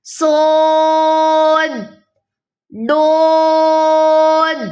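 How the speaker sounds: صاد and ضاد drawn out slowly with a full mouth; chanted heavy letters; heavy letter names. Shown as a demonstration. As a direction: fill the mouth and stretch each. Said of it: "منہ خوب خوب اس کو بھریں گے".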